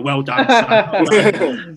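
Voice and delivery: very slow, laughing praise; laughing through it. Retold or imitated. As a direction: chuckle the words out slowly. Manager praising, teller laughing.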